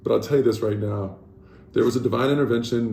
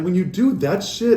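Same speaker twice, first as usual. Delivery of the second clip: usual speaking voice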